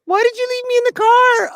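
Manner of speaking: put-on high sweet toddler voice; little girl asking. Toddler in the back seat asking her dad, high and sweet. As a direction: ask it high like a three-year-old.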